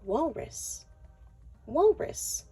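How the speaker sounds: plain reading voice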